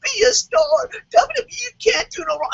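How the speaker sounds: shrill mock sob of a fan who defends everything; mocking whiny fan. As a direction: sneer at the always-positive fans in a crying falsetto. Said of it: "you're not total divas and".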